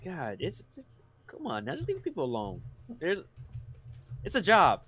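choppy audio dropping in and out; audio cutting out. Real, not acted. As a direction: voice breaking up over a bad mic. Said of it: "[name], your audio's cutting in and out".

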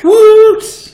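high loud cheer; excited whoop. Real, not acted. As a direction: whoop it out in celebration. Cheer on return.

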